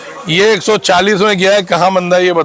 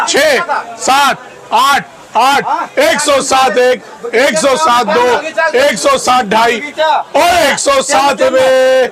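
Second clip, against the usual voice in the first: auctioneer; 5 semitones higher, slower than usual. Acted. Auctioneer calling bids.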